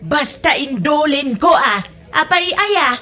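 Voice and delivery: mother refusing to say where gifts are, annoyed insistence. Acted. Mother snaps at child over hoarded gifts.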